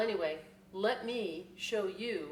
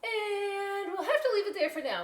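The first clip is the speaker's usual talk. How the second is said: high, loud put-on teacher voice; teacher closing the book; 14 semitones higher, 7 dB louder than usual. Miss C ending story time, higher louder voice. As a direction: stop the story gently but firmly. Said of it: "Miss C said".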